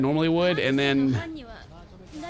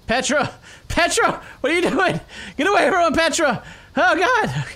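high, urgent shouts; alarmed calling; 10 semitones higher than usual. Real, not acted. Player warning Petra away from the Titan, alarmed. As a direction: call out in a panic, pitch up.

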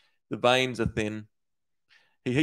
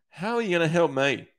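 tearful; 7 semitones higher, 3 dB louder than usual. A narrator's reading. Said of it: "She starts crying a little".

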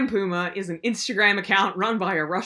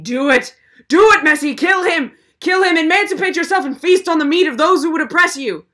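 loud high battle cry; rallying shout; 8 semitones higher, 7 dB louder than usual. Acted. Cheering Messi to turn on his owners, a loud battle cry. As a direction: egg on the puma like a rebel leader.

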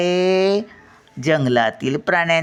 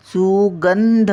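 सुगंध slowly, गं pulled high and louder; stress on सुगं; 4 semitones higher, 3 dB louder, much slower than usual. Shown as a demonstration. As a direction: hold and lift गं in सुगंध. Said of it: "उंच ओढून घेतो शब्द कुठे?". (सुगंध मध्ये गं उंच ओढून)

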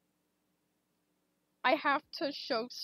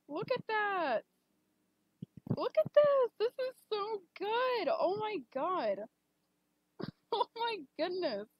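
excited reveal; 7 semitones higher, 4 dB louder than usual. Real, not acted. Shows fan art to stream.

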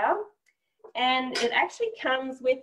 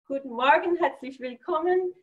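a German greeting in a native German accent, a little higher; speaking German; 5 semitones higher than usual. Shown as a demonstration. Asked by the host, she greets in native-accented German. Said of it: "Could you just say a couple words?".

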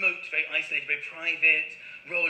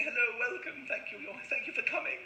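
soft bird voice; 3 semitones higher, 8 dB quieter than usual. Pretend. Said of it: "Like they were saying".

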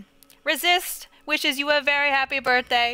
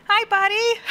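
pet talk; 7 semitones higher, 4 dB louder than usual. Real, not acted. Streamer greets her dog Kodo in a cooing voice. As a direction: coo sweetly at your pup.

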